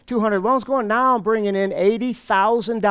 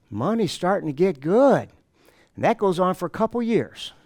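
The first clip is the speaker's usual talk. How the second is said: ordinary delivery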